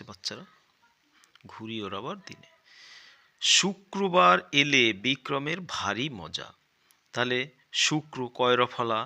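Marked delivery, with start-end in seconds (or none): 3.4-6.5 loud, slow storybook reading; reading aloud. Acted. Teacher reading the primer story to children, loud and slow. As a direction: read the passage out grandly for the class.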